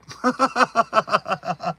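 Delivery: long, rapid high giggle; real amused cackling; laughing at the punchline. Real, not acted. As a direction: crack up at your own punchline.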